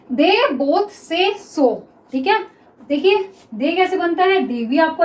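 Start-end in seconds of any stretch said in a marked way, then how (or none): 0.1-1.7 each word drawn out slowly; slow dictation. Shown as a demonstration. Teacher dictates the next sentence to students, very slow. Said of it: "next देखिए".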